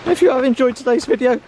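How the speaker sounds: high, loud cheery sign-off; bright YouTube goodbye to viewers; outro sign-off. Acted. Channel sign-off.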